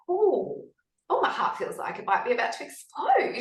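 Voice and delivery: high, wondering "oh"; retold amazement with a laugh creeping in; excited fascination. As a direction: marvel at your own symptoms. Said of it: "I got really excited".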